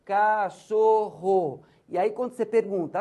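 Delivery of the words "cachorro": Cachorro stretched slowly, syllable by syllable. Shown as a demonstration. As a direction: sound out Cachorro slowly for the children. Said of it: "fazer esse ajuste".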